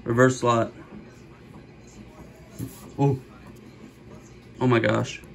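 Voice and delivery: normal talking voice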